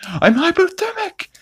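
mock freak-out in high falsetto; Stewie. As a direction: imitate a squealing cartoon panic. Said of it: "and Stewie freaks out".

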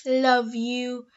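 usual speaking voice

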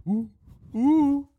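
high, loud gasping ooh; thrilled at the numbers; excited gasp. Retold or imitated. Watching view count climb.

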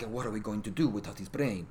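badly faked accent, low and soft; horrible Wonder Woman accent. Wonder Woman at Bruce's grave, in a badly faked accent. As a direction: attempt a foreign heroine's accent, softly and badly. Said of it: "That was a horrible accent".

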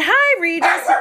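put-on high, sing-song hello to a kid; cheery greeting. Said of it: "I said".

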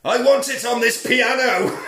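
high, loud gleeful command; villain order. Retold or imitated. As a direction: bark the order with relish. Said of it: "He says".

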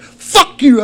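high, loud cursing shout; angry retort. Retold or imitated. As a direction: snap back at full volume, re-enacting the row. Retold argument with wife.

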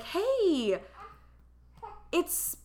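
high, bright phone greeting from the clinic nurse; a cheery nurse calling a patient, imitated; chirpy nurse voice. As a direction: sing out the hello like a cheery receptionist. Retelling clinic nurse's phone call.

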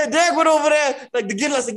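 ordinary delivery

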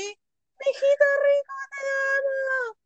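very high, slow gushing squeal; high swooning fan cry; fangirl squeal. Retold or imitated. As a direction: squeal it like a starstruck fan seeing her idol. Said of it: "¿qué así cuando lo vi?".

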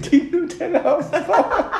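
high and slow through laughter; laughing. Real, not acted. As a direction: giggle it out delightedly. Laughing excited image. (lachend und hoch)

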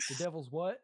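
a light southern twang on a question; lightly Southern-accented mock surprise. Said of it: "my voice went really southern".

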